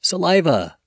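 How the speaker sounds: saliva said as suh-LYE-vuh, stress on the middle syllable; saliva, clearly in three syllables, suh-LYE-vuh; slow clear dictionary pronunciation. Shown as a demonstration. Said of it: "This word is pronounced as".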